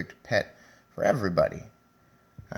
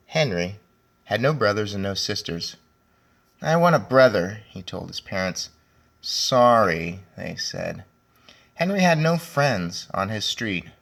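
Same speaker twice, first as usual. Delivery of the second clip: lively, a bit louder storybook reading; reading to children; 5 dB louder than usual. A narrator's reading. Teacher reading Henry and Mudge to her class, lively storybook voice. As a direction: read it out brightly for the class. Teacher reads picture book aloud.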